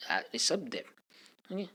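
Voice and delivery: ordinary delivery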